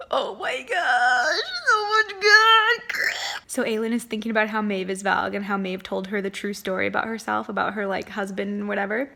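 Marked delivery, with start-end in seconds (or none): 0.0-3.1 gasping, tearful and slow; moved almost to tears; overwhelmed gasp. Real, not acted. Overwhelmed reader gasps, near tears.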